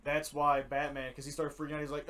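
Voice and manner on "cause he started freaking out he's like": ordinary talk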